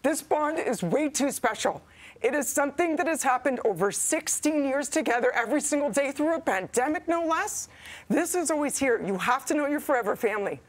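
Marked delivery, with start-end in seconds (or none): 0.0-9.0 pushing words out through tears; emotional farewell, still crying; still tearful. Real, not acted. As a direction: voice thin and high from crying. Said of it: "I said I wasn't gonna cry".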